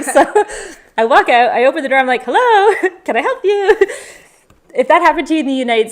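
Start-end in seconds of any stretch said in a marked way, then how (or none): 2.2-3.8 high, loud, laughing hail; loud sing-out greeting with a laugh; retold calling out. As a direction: call out to a stranger, amused.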